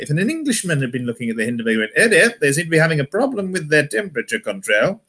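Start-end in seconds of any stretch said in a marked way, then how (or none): 2.0-5.0 mildly concerned posh English, pitched up; underplay a disaster in mock RP; understated English. Englishman watching the Hindenburg burn, understated posh English. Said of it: "the understated nature of the English accent".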